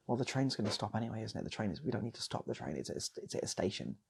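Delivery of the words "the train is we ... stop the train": usual speaking voice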